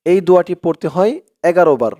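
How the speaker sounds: ordinary talk, nothing marked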